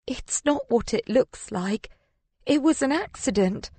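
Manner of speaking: high, strained excuse; croak. A narrator's reading. Woman explaining the body to Jack, croaking. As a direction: plead innocence with a slight croak. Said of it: "I croak".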